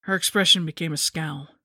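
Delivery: usual speaking voice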